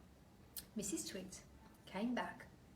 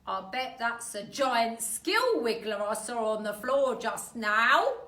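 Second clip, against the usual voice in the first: put-on louder, sly old husband; Mr Twit voice; 2 semitones higher, 13 dB louder than usual. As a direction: tease her slyly. Mister Twit teasing wife.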